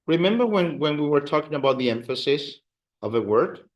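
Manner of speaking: ordinary talk, nothing marked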